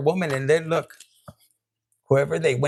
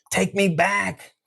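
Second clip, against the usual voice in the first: slow begging; slower than usual. Retold or imitated. Ex begging the viewer.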